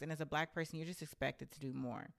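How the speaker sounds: ordinary talk, nothing marked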